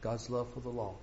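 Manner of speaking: quiet, reverent. Shown as a demonstration. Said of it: "repeated key phrase, first take".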